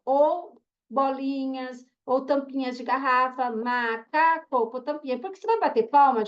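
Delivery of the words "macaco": macaco said slowly, syllable by syllable. Shown as a demonstration. Teacher to class, sounding out macaco by syllables. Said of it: "eu gosto no dedinho".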